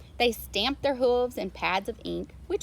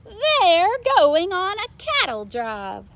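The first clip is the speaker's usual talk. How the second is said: huge high shout, then slower; farmer shouting; 11 semitones higher, 11 dB louder, slower than usual. A narrator's reading. Farmer yelling after his runaway cows. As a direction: bellow it like a frantic farmer. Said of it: "The farmer shouted".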